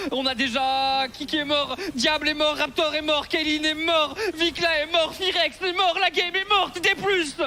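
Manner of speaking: rising high and loud roll call; hype shout. Real, not acted. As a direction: scream every kill. Caster yelling out a team wipe.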